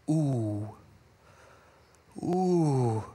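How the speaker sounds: low hushed ooh of anticipation; soft low ooh. Real, not acted. Preacher reacting to the next verse with low ooh. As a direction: savor the moment.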